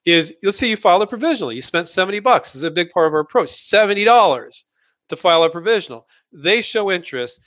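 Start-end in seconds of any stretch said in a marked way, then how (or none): none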